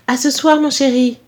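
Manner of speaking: lilting maternal farewell; affectionate mother. Acted. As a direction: send your darling off fondly. Mother says goodbye to son.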